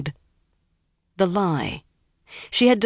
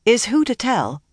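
higher, matter-of-fact restart; as if nothing happened; 6 semitones higher than usual. A narrator's reading. Egwene resumes after the quarrel, as if nothing happened. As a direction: move on with understated unconcern. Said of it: "as if nothing at all had happened".

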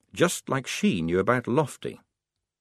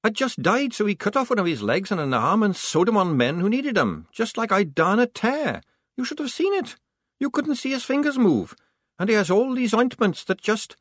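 put-on high, eager young voice; higher young soldier rambling; Tonka voice; 12 semitones higher than usual. A narrator's reading. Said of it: "Tonka whispering to his girl".

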